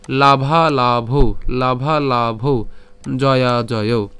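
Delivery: লাভালাভৌ drawn out slowly, syllable by syllable, ending in au; slow clear pronunciation. Shown as a demonstration. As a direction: spell out লাভালাভৌ slowly for students. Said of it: "ঠিক আছে".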